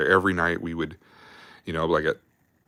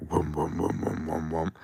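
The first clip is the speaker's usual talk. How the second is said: imitated exhaust puffs; 7 dB quieter than usual. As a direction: mimic the exhaust blowing in his face. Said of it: "the exhaust is just like".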